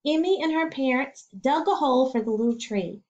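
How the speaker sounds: ordinary delivery, nothing marked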